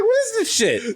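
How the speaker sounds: very high-pitched squawk; hammed-up incredulous outburst; outraged disbelief. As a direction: explode at an absurd order. Acted outraged customer.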